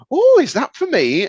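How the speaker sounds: high, bright mock-surprised mum; mum's eager voice. Teller voicing mum spotting the box, high and eager. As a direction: squeak it like an excited mum.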